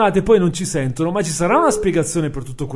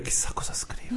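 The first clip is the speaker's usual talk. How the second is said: whispering; 14 dB quieter than usual. Real, not acted. Whispers about Maddy writing.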